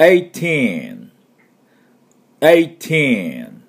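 put-on "eighteen" with one T and a long falling TEEN; single T. Shown as a demonstration. Teacher leading repetition of eighteen, one T, long TEEN. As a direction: run eight into teen in "eighteen", stretching the end. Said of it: "tは一回しか発音しません".

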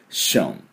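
ション from a long hush into "un"; SH-sound ending. Shown as a demonstration. Teacher completing the word's ending, hushing SH. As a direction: start ション with a shushing SH. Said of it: "うるさい時のシって音ですね".

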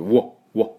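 わ with rounded lips, muffled. Shown as a demonstration. Teacher showing the muffled w to learners, rounded. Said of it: "こもった感じ".

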